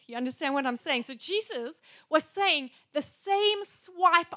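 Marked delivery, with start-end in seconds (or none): none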